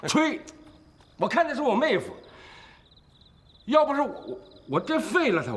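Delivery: high-pitched, puffed-up threat; empty bluster. Acted. Beaten man threatens his brother-in-law, hollow bravado. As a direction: boast as if held back from a fight. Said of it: "外强中干".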